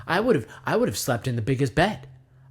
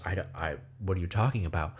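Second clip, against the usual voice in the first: low, soft, hesitant bear voice; Papa Bear; 7 semitones lower, 7 dB quieter, faster than usual. Acted. Papa Bear answering the police at his door, low and bewildered. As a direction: stammer it low and quietly, taken aback. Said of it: "the Papa Bear goes".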